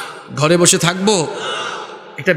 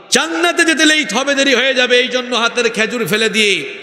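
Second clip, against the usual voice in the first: high-pitched preaching cry, gradually descending; impassioned proclamation; 6 semitones higher than usual. Retold or imitated. Preacher voices the Prophet testifying for his companion, impassioned proclamation. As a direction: proclaim it fervently in one surge.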